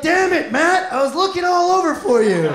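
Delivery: mock exasperated shout; exasperated boss. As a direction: boss who searched everywhere. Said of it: "He's like".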